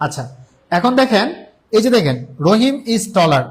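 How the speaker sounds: ordinary talk, nothing marked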